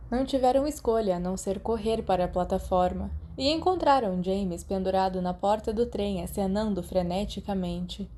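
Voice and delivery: ordinary delivery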